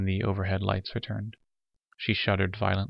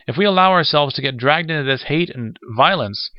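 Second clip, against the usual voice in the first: high, loud appeal; 7 semitones higher, 7 dB louder than usual. A narrator's reading. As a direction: plead urgently, voice raised. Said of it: "he appealed to Patterson".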